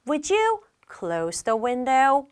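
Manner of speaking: slow, politely softened request; polite customer asking to close the window. Shown as a demonstration. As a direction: ask it gently and courteously. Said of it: "되게 정중하죠".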